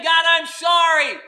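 crying prayer. Retold or imitated. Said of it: "He was praying".

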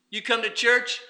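ordinary delivery

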